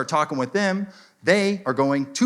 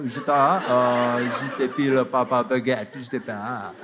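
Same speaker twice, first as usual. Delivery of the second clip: mock French. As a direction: fake French with choking interjections. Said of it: "They choke on an invisible penis".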